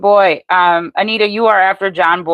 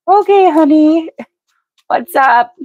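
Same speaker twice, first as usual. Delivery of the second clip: much higher and louder, sweet and motherly; sweet everyday voice; 11 semitones higher, 4 dB louder than usual. Shown as a demonstration. Showing viewers how she talks to her grandkids, sweet and high. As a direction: chirp it like greeting a grandkid. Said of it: "that's how I normally speak".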